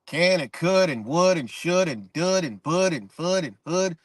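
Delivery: slow, looping nonsense rhyme; mocking singsong list. Vlogger mocking baffling can-and-could code, singsong ridicule. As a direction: chant it in mockery, each word rolling alike. Mocks the gibberish picks he heard.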